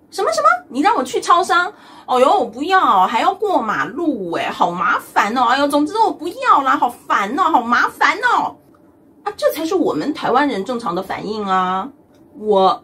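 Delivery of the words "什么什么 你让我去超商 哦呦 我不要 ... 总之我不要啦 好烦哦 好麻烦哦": high, whining, stretched-out refusal; mock tantrum over going to the store; whiny refusal. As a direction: act like the errand is unbearable. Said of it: "你一定要先嫌弃再说".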